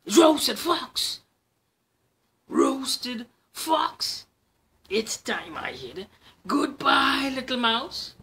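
high, loud panicked fox; frightened fox. Acted. Fox panics at the mouse's words. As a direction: yelp it in alarm.

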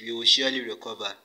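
ordinary talk, nothing marked

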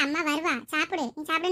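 ordinary talk

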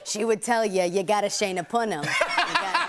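thick put-on Long Island accent; exaggerated Long Island vowels; grandmother's Long Island accent. Shown as a demonstration. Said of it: "She talks like this".

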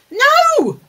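sharp, high, loud cry of alarm; sudden shout. Real, not acted. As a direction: yell it out as the dog grabs the cheese. Dog grabs the food.

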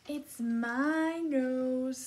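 loud, high, very drawn-out crocodile voice; snapping crocodile. A narrator's reading. Crocodile claiming its nose in a picture book, mock snapping. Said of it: "snapped the crocodile".

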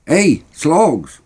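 high-pitched call to a mate. Acted. Boys call Slogs back from the corner, shouting. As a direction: hail him from down the street. Calling friend back down the street.

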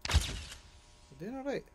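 a quiet, understated echo; soft self-reassurance. Real, not acted. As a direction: tell yourself quietly it's fine. Repeats it softly to himself.

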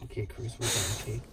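quiet, calming murmur; soft soothing. Real, not acted. Owner calming the dog after play, soft and soothing. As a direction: settle him down gently.

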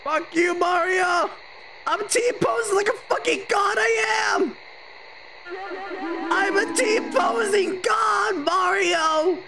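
hammed-up shouted gloating; triumphant taunting shout. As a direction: crow it like a mad champion.

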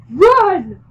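high, very loud urgent command; yelled. A narrator's reading. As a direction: yell it as an urgent order. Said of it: "he yelled at me".

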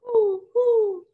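high, loud hooting owl call. Acted. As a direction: hoot the words like an owl to the kids. Owl hoots in picture book.

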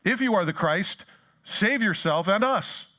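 loud, high-pitched taunt, slowing at the end; blaspheming jeer. Acted. Hanged criminal to Jesus, loud mocking jeer. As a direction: hurl it up loudly, stretching the final demand. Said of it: "blasphemed him".

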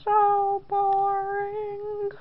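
bored drawl. Acted. Child to mother after the Bible reading, slow bored drawl.

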